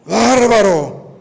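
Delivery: loud, very slow, stretched cry of amazement; astonished exclamation. Real, not acted. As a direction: let the wonder burst out. Exclamación de asombro. (exclamación de asombro, fuerte y muy lenta)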